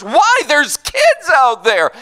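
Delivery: high, loud mock protest; imitating an indignant pastor; Dan's mock outrage. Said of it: "He gets mad when I say".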